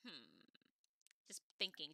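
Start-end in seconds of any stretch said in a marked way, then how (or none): 0.1-0.5 low, soft pondering hum; quietly plotting hum; scheming. Acted. Brother just thinking, just scheming, calculating hum. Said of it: "just scheming".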